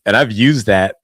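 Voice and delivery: ordinary talk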